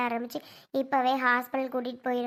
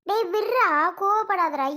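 urgent shout; 6 semitones higher, 5 dB louder than usual. Acted. Mother stops son's angry outburst.

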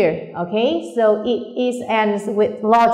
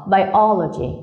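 biology with the second syllable O stressed; slower than usual. Shown as a demonstration. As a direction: slowly say biology, leaning on the O after bi. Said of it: "nhấn ngay vào chữ O".

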